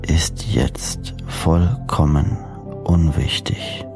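ordinary talk

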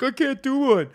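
high-pitched little boy imitation; kid's reluctant reply. Kid refuses to do accent.

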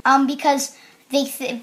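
usual speaking voice

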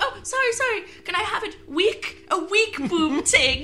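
put-on high apologetic old lady voice; man doing granny voice. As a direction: fuss politely like a frail granny. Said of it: "In an old lady voice".

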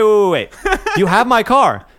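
high and loud, cutting in; retold shocked interruption; stunned disbelief. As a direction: stop him mid-sentence, can't believe it. Stunned on phone with chief.

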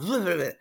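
loud, high babbling trill; imitated rabbiting noise. Patient shows how she rabbited on, babbled noise. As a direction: imitate words pouring out nonstop. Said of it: "just rabbiting. It was like".

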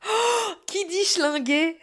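high, slow gasp of fake outrage; mock shocked gasp. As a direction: clutch your pearls at the word schlinguer. Mock outrage at own word.

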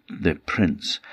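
usual speaking voice

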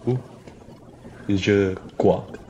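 French duck accent. Shown as a demonstration. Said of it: "a French one would be like".

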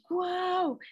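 high excited wow; a thrilled burst of wonder; delighted wow. Real, not acted. Wowing at a listener's age, thrilled.

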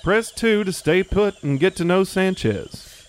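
slow automated menu option; phone menu voice. Acted. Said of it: "press one for English".

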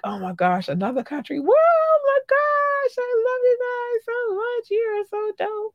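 excited squeal climbing very high; inner excitement. Acted. Host voicing her thoughts at another new country, excited squeal. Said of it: "that's really how it goes in my head".

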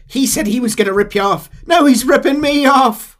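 high, loud pleading Irish voice; Irish accent. Retold or imitated. Irishman pleading with his captors, Irish accent. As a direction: beg in a comic Irish accent. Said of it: "Ira says".

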